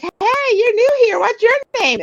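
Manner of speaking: bright high welcoming voice; friendly greeting. Retold or imitated. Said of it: "everybody's like".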